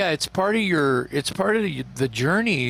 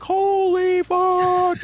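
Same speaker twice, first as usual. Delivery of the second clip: much higher, louder and slowly drawn out; stunned dread; 4 dB louder, much slower than usual. Retold or imitated. Host voicing the singer as the tapes roll, stunned drawn-out cry. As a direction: stretch it out in wide-eyed panic. Imagining tape starting rolling.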